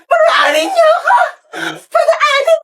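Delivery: very high, wailing shout; comic screaming tantrum; hysterical shriek. Acted. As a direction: shriek and wail to be let through. Asking to pass turns hysterical.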